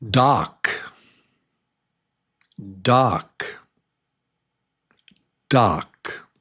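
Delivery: "Dock" said clearly with an open "ah", then paused; quiz word. Shown as a demonstration. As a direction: give the test word "Dock" deliberately. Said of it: "I'm gonna say one of these words".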